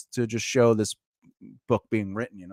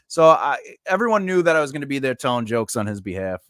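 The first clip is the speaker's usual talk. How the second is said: ordinary delivery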